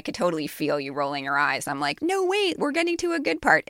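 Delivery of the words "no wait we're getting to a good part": much higher, mock-urgent plea; pleading inner voice. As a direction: beg them to hang on, high-pitched. Acts out thought pleading with listener.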